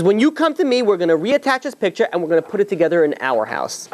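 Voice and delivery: high-pitched retold protest, easing down at the end; insistent. Wife telling husband they will rejoin the picture, insistent.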